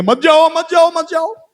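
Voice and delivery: high-pitched repeated begging; frantic family pleading. Acted. Family begs dying loved one to stay, high pleading. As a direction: imitate relatives pleading in a high, urgent voice.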